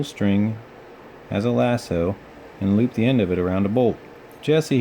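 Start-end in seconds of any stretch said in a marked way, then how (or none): 4.4-4.8 higher call of her name; shouted. A narrator's reading. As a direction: shout to her over the plane noise. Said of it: "he shouted".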